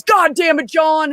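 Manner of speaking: high-pitched mock angry holler; imitating pissed-off yell. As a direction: call out in exasperated fury, retold. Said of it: "Oh, he was pissed".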